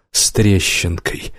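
plain reading voice

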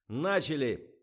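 high, loud start call; excited command. A narrator's reading. Director jumps about starting the shoot, excited shout. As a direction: kick off the shoot with bouncing excitement. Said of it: "запрыгал он".

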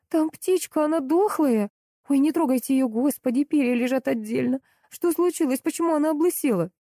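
high, horrified exclamations; panicked yelling. A narrator's reading. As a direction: keep the pitch up in a flustered panic. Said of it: "заорала я".

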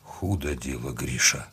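low, soft, strained; wounded man fading, low and faint; weak, failing voice. A narrator's reading. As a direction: let the voice give out as you speak. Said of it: "Голос сорвался".

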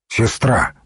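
ordinary delivery

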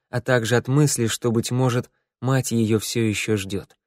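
ordinary delivery, nothing marked

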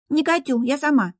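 put-on high, whiny little girl refusing; whiny spoiled pouty drawl. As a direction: pout and whine like a stubborn child. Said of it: "закапризничала дочь".

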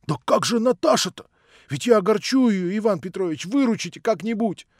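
high, loud, urgent plea; pleading. A narrator's reading. Alyosha begging Ivan Petrovich to rescue him, pleading. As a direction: beg to be rescued from a fix.

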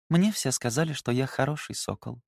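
half asleep, words trailing away; sleepy mumble. A narrator's reading. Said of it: "сонно пробормотала".

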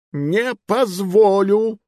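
high, loud, very slow snap; snapping refusal. Acted. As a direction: cut him off sharply. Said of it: "огрызнулся король".

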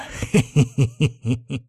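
wicked little run of laughter; gloating chuckle. A narrator's reading. Teacher relishes scaring his pupils, gloating chuckle. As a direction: chuckle slyly at your own idea.

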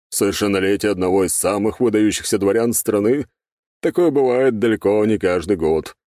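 expansive, courteous guest; Павел Александрович. A narrator's reading. Governor flatters the young prince, expansive and courteous.

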